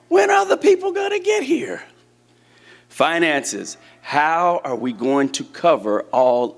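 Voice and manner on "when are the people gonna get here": very high-pitched anxious question; hammed-up worry, voice way up high; mock fretting. Voicing a worried leader's question.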